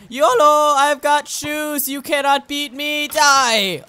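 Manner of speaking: loud, high mock-heroic war cry; battle cry. Gamer charging at a skeleton, battle cry. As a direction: charge in yelling, drawn out and triumphant.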